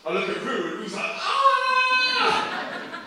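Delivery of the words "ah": long, high-pitched mock scream; imitated terrified scream. Preacher mimics his guitarist panicking on the plane, screaming.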